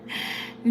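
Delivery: quiet airy laugh under the breath; soft breathy giggle. Real, not acted. Reading her horoscope, a quiet giggle. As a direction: let a little laugh slip out.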